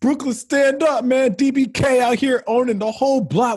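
high, hyped hometown cheer; big up Brooklyn; repping his borough, pitched high and hyped; hype shout-out. Real, not acted.

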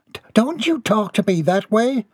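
high, stammering retort; indignant muffled reply. A narrator's reading. Mother answers her son back, high stammering retort. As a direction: stumble into a high-voiced protest. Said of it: "came the muffled reply".

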